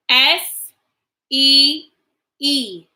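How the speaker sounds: letter by letter, clear and paced; spelling aloud. Shown as a demonstration. Teacher spelling a sight word for the class, letter by letter. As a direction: spell it out slowly for little ones. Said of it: "Now let's spell them".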